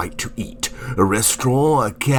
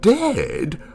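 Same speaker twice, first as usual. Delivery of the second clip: much higher, incredulous echo; shocked disbelief; 12 semitones higher than usual. Acted. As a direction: let the news hit, voice leaping up. The news of death sinks in.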